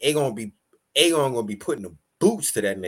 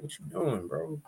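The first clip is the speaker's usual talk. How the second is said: quiet, laid-back what you doing; calm Daemon; 14 dB quieter than usual. Retold or imitated. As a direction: softly ask him to calm down. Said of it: "Damon once again is like".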